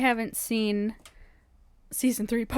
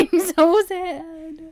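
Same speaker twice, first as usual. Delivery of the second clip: mock crying; 7 semitones higher, 9 dB louder, much slower than usual. Mock grief over the Queen.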